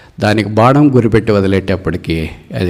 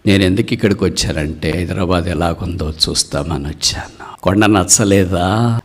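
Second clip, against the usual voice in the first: ordinary delivery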